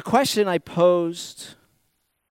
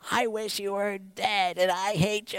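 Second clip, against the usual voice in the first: tag; 4 semitones higher than usual. Acted. Voices child lashing out at parent.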